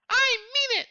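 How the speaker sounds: put-on high, squeaky child's protest; distressed kid. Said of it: "like, distress. Like, he is bawling".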